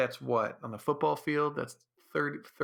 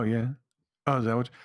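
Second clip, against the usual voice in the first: ordinary talk, nothing marked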